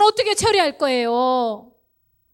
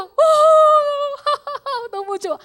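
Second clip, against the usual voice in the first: joyful laugh; 10 semitones higher, 4 dB louder than usual. Pretend. Believer looking at the cross, laughing with joy. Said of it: "이렇게 웃어야 되는데".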